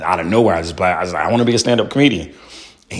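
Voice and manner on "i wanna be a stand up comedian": blurted out. Retold or imitated. Blurts dream out of nowhere.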